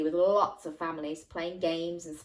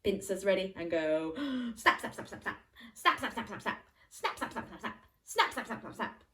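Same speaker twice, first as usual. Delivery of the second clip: snapping game. Pretend. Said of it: "get your crab pincers ready".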